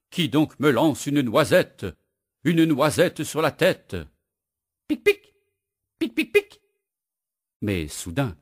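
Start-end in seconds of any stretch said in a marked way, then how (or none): 0.1-4.0 raised, louder startled shout; crying out. Acted. Woodcutter yells at whoever throws nuts. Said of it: "Le bûcheron crie". 4.9-6.6 very high squeaky pics. Acted. Squirrel's nut tapping on the head, squeaky pings.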